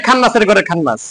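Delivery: lightly voiced, mocking curse; mock insult. Teacher imitating how people curse each other, mildly mocking. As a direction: toss it off like a street insult. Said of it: "মানুষ গালি দেয় না".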